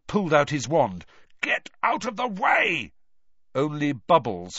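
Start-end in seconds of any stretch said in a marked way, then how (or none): 1.4-2.9 high-pitched narrated yell; urgent shout. A narrator's reading. Harry orders the mermen aside with his wand out, shouting. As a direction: call out sharply, pitched well above the narration.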